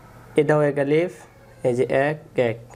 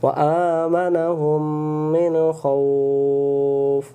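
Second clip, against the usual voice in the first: very slow, melodic drawn-out recitation; Quran recitation; much slower than usual. Shown as a demonstration. Teacher modelling the correct words for students, slow chant. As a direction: stretch মিন and জু'ইউ in a long chant. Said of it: "recites word as model".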